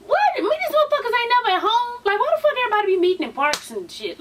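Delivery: high exasperated rant. Real, not acted. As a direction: throw hands up, exasperated.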